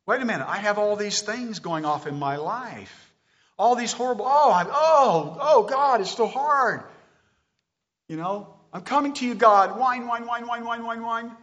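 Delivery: high, hammed-up whining to God; whiny complaining prayer. Preacher mocks a complaining believer praying, whiny.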